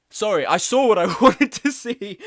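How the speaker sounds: giggling through the words; laughing. Real, not acted. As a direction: laugh while apologising. Laughing at a chat message.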